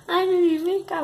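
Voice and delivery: high, loud cooing at a pet; pet-talk voice. Shown as a demonstration. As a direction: coo at the dog in a sing-song. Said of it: "você tem que fazer uma voz desse jeito". (voz fininha e alta de falar com cachorro)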